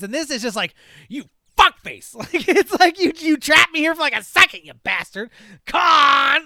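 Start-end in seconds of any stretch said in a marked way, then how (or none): 1.1-5.3 loud, high, mock-indignant rant; hammed-up petty outrage; petty yelling. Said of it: "it's just like you're yelling". 5.7-6.5 high, loud mock yell of a name; exaggerated squabbling shout; petty yell. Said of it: "it's just like you're yelling at your".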